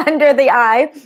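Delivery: calm, steady affirmation voice; guided tapping. Acted. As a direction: recite each tapping point soothingly. Leads tapping script aloud.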